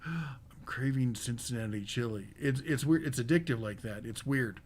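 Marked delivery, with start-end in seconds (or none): 0.0-2.3 low, soft mock revelation; hushed realization. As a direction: drop low and soft, as if it just dawned. Acting out a sudden craving realization.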